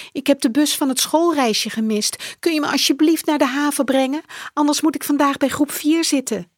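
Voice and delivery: put-on high, fast, urgent boy's plea; pleading boy. Max begging his mother for a lift, high and hurried. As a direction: rush the request, begging.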